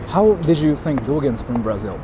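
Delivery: usual speaking voice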